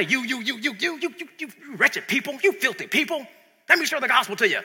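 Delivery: condemning street preacher. Retold or imitated. A street preacher berating sinners, in mock condemnation. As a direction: jab the finger at them with each you. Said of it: "They'll yell on the corner".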